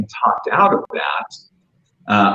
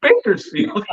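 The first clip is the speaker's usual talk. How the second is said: high, disbelieving echo; incredulous; 12 semitones higher, slower than usual. Retold or imitated. Customer echoing the waitress's hometown in high disbelief. Incredulous reaction to her answer.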